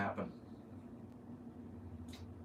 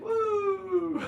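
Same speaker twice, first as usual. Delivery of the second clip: mock cheer; 4 dB louder than usual. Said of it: "and they're like".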